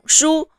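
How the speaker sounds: shu high and level; first tone. Shown as a demonstration. Teacher showing learners the first tone, high level. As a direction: hold shu on a high, steady pitch. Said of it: "The syllable with the first tone".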